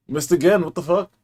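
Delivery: loud, high gamer rage; frustrated outburst. Real, not acted. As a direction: yell at the missed shot. Missing a shot in the game.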